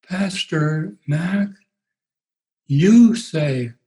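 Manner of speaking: very slow, dragged-out words; a lightly voiced sedated woman, slow; medicated voice. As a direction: Marilyn confronting her pastor, slow and drugged. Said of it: "in her medicated voice, this is the way it sounded".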